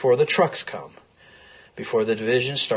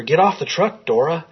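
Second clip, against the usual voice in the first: higher, raised calling out to a wife on a truck; pitched-up retold yell, not full volume; retold shout; 7 semitones higher, 4 dB louder than usual. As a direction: call after her as the truck pulls away, a mild mock shout. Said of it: "he shouts to her".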